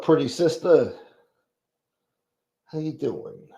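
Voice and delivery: ordinary delivery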